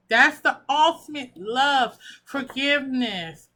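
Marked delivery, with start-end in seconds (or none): none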